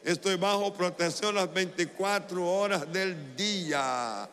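loud, high, rousing preacher voice; rally the congregation into declaring it aloud; full-force preaching. Real, not acted.

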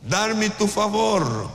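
slow, drawn-out plea; slow earnest plea for favor. Shown as a demonstration. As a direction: stretch each word like a prayer. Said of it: "¿Cuál es la petición?".

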